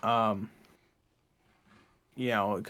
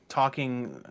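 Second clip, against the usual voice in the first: ordinary talk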